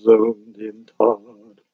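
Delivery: very slow, drawn-out mock trance voice; mock zoned-out viewer. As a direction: drag it out like a hypnotised fan. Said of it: "all gonna be like".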